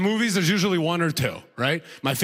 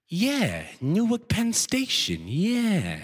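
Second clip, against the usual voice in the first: put-on slow, higher, swooping old-man echo; senile older guy; 6 semitones higher, much slower than usual. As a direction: chime in cluelessly with stretched words. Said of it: "the second older guy goes".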